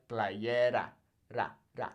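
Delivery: playera with a single light tapped R. Shown as a demonstration. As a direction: tap the R once in playera. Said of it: "Not R, ra".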